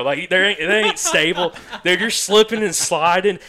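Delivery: can't keep from laughing at himself; laughing while telling. Real, not acted. Laughing as he tells friends about wobbling in boot covers.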